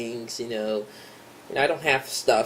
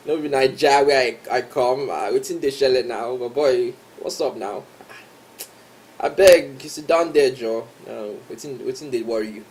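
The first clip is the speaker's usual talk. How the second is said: playful Nigerian Pidgin with a mate, a few words louder; Nigerian Pidgin banter; 3 dB louder than usual. Shown as a demonstration. Vlogger showing off his Pidgin to viewers, playful Nigerian Pidgin. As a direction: throw Pidgin at a friend, loose and joking. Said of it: "I sabi yarn Pidgin now".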